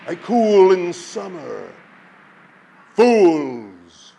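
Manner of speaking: slow, swelling storm voice with sudden drops; booming wind. A narrator's reading. The wind scolds the frightened people, booming and slow. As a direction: lecture the fools like a mighty storm. Wind's long speech.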